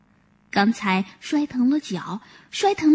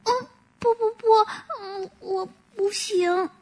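timid, near tears; 8 semitones higher, 5 dB quieter than usual. Acted. Little deer timidly refusing the monkey, near tears. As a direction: shrink back and whimper no. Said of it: "胆怯地说".

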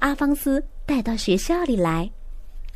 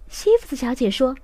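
ordinary delivery, nothing marked

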